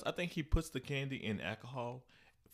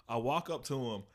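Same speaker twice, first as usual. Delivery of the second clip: ordinary delivery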